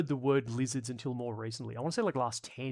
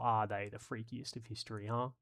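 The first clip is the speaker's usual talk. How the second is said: normal talking voice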